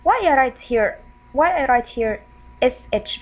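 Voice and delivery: ordinary talk, nothing marked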